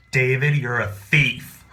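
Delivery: slow, loud public accusation. Retold or imitated. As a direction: announce it so everyone hears. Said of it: "in this very loud voice".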